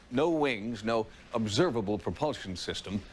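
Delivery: normal talking voice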